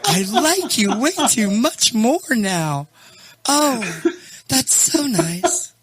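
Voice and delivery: very high, laughing squeal of delight; delighted gushing. Real, not acted. Host thrilled with co-host's new echo, gushing high.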